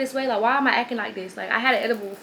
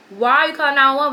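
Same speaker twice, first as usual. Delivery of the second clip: retold alarm; 4 semitones higher, 6 dB louder than usual. Acting out alarm at 911 call.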